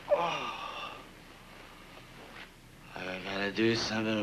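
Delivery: weary, pained mumble; hungover groaning. Acted. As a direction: nurse a pounding headache. Hungover, head aching.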